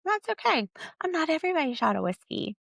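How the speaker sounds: breezy, high-pitched shrug-off; sassy high. Real, not acted. Podcaster shrugging off haters with sass. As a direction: brush it off with a lilt.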